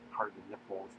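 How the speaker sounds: ordinary delivery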